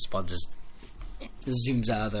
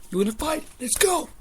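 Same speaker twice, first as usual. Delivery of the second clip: much higher, louder, rising "Let's go"; fired-up challenge; 13 semitones higher, 4 dB louder than usual. Retold or imitated. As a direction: accept the fight, hyped. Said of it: "Drew goes".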